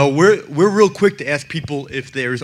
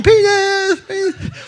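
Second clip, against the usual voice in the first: put-on high-pitched little-girl yelling, repeated; daughter yelling; 3 dB louder, slower than usual. Little daughter calling out in the art museum, high mock yell. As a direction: mimic a small girl calling out the word over and over. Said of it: "she's yelling".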